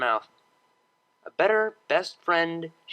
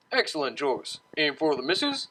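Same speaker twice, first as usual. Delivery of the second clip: drawling waiter. A narrator's reading. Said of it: "he drawled".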